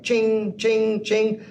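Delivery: high chiming imitation of the order alert; playful cash-register chime; ching sound. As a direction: ring it out like a till bell.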